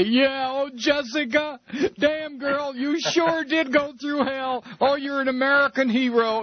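high-pitched mock gushing over a hero; gushing TV viewer. Host mimicking viewers cheering Jessica Lynch, high mock gushing. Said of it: "eighty-five percent of us will sit there".

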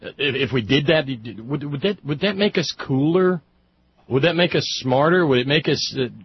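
sarcastic mockery. Host mocking with dramatic music sting.

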